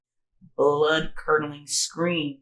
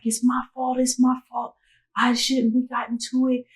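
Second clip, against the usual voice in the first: high-pitched, distraught self-blame; pleading, high, blaming yourself; guilty panic; 8 semitones higher than usual. Retold or imitated. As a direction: reenact panicked guilt in a raised, strained pitch.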